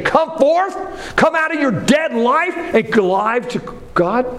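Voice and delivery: high, ringing preacher's call; urgent summons. Acted. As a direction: call them out with rising urgency. Preacher applies the call to congregation.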